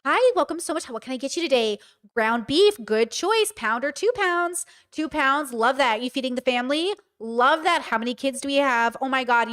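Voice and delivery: chatty little waitress voice; eager-to-please counter-service voice; customer service voice. Acted. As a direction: put on your customer service patter. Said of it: "customer service voice".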